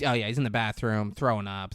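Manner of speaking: usual speaking voice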